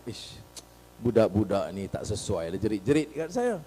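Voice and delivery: low, very soft aside; private grumble. Retold or imitated. Politician disapproves of youths shouting at him, low and hushed. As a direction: grumble under your breath.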